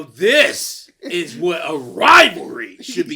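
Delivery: loud, slowed, fired-up rallying cry; pumped fan yelling; hyped-up shout. Retold or imitated. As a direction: roar it like a fan hyping the rivalry. Hyping the rivalry, acted quote.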